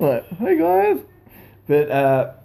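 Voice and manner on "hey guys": put-on high wobbly old man voice; Jimmy Hart impression. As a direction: imitate an old wrestling manager greeting. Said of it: "he walks around like this now".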